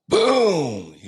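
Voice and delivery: sudden sharp high boom; shouted boom for the gunshot; loud gunshot boom. Retold or imitated. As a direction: burst out with the gunshot. Gunshot imitated.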